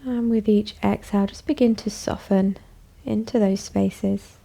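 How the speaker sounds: calm, even voice with long pauses; guided meditation. Acted. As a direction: guide them gently inward, leaving space after each phrase. Leads a bedtime meditation.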